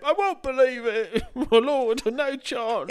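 high, loud put-on voice, laughing; hammed-up incredulous listener; mock disbelief. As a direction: refuse to believe it, squealing.

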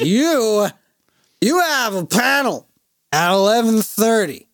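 high, loud slurring reminder; drunk insisting. Retold or imitated.

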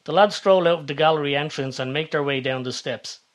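usual speaking voice

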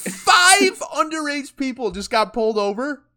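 high, loud and slow, laughing through it; laughing disbelief. Real, not acted. Friend laughing while retelling the traffic stop. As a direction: marvel at the absurd luck while laughing.

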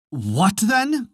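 crying out. A narrator's reading. Laughing crowd to the wee man, crying out. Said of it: "cried they".

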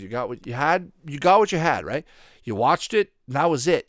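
usual speaking voice

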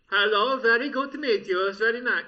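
loud, high mock Indian accent. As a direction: put on a big Indian accent.

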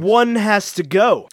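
slowly, weighing each word; mock-solemn announcement; slow dramatic read. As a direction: read it out like a grim verdict. Repeating the meme caption.

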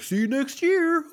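very high, loud squeaky mimicry; cartoonish high impression of a cheesy coworker; mocking office joker. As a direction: mimic the annoying joker in a shrill put-on. Mocking an office joker on New Year's Eve.

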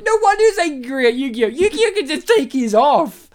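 laughing high through the words. Real, not acted. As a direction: squeal it with laughter. Laughing rant about the character.

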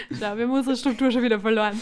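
real giggly outburst, words pushed out over laughs; laughing. Real, not acted. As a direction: crack up while speaking. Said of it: "Die [name] lacht, warum lacht die [name]?".

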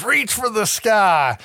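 high, slow tough-guy line; slow, high showdown quip; cowboy hold-up line. Retold or imitated. Hero holds up gang with gun.

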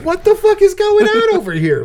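high, loud outraged what-the-fuck; shocked disbelief. Retold or imitated. Viewer reacting to the twist reveal, shrieking in shock. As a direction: blurt it out in total disbelief. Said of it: "No, I'm like".

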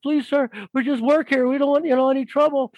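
high, anxious imitation of counter staff; mock pleading. Counter workers beg the biker for no trouble, mock pleading. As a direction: plead your way out, hammed up in a high voice.

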